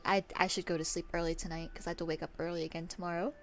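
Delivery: ordinary talk, nothing marked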